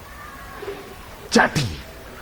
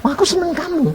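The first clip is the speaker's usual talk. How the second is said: high, light voice for God's reply; playful high voice of God; voicing God. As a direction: speak God's words lightly and fondly. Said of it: "Tuhan bilang".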